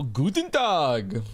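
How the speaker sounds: slow, deliberate mock-German "Guten Tag"; proud tourist saying "Guten Tag"; practiced German greeting. Shown as a demonstration. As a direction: show off your German by stretching out "Guten Tag". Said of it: "I've been practicing my German".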